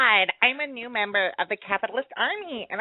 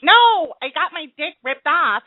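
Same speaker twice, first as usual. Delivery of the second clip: a hint of a higher put-on caller voice, slow and loud; 5 semitones higher, 7 dB louder than usual. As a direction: keep the fake voice up, slowly. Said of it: "say your voice, like your regular voice".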